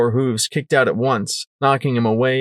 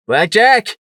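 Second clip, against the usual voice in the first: high, loud cry of a name; urgent call; 10 semitones higher, 7 dB louder than usual. Acted. Rampage bursting in, calling Blackjack loudly. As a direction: shout her name as you burst in.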